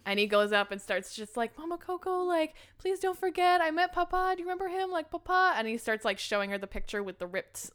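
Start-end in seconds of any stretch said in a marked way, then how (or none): 1.6-5.5 high-pitched pleading, acted out; imitated pleading. Boy begs great-grandmother to remember Papa, pleading. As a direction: retell an urgent plea in a higher voice. Retold desperate plea to Mama Coco.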